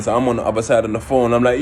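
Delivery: ordinary talk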